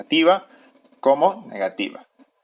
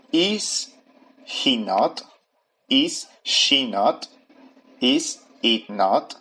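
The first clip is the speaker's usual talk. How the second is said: slow pronunciation drill; much slower than usual. Shown as a demonstration. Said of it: "¿cómo sería la pronunciación?".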